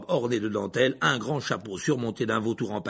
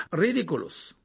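casual, unraised spell; almost nonchalant; 3 semitones higher than usual. A narrator's reading. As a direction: toss the incantation off lightly. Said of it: "d'un ton presque nonchalant". (ton presque nonchalant)

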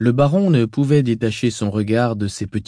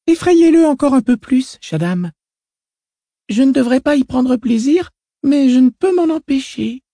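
put-on high, gleeful little girl's voice; a small girl relishing his fear; child voice; 6 dB louder than usual. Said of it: "cessa de balancer les pieds et dit".

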